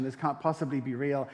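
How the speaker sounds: normal talking voice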